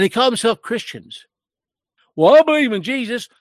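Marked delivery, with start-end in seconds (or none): 2.2-3.3 higher, louder mock profession of faith; mock professed believer. Preacher mimicking people who call themselves Christians, mocking. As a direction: echo their empty claim, pitched up and louder.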